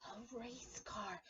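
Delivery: hushed, slow secret whisper; whispered reveal. Acted. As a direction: let them in on a secret. Rabbit's secret answer.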